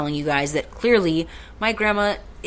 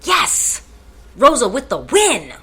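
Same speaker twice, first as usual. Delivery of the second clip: high, loud burst of triumph; triumphant cheer; 7 semitones higher, 6 dB louder, slower than usual. Real, not acted. Cheering at finding the app, triumphant. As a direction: call it out like a win.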